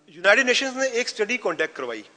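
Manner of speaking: ordinary talk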